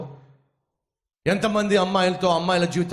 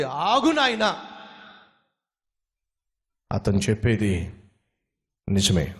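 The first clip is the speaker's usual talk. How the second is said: put-on calm old man; 4 semitones lower, slower than usual. Lame old man calms thug.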